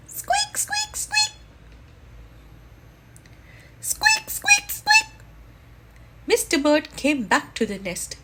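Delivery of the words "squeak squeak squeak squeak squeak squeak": very high, thin squeaks; squeaking chick. Acted. The unhatched baby squeaking inside the egg, tiny and shrill.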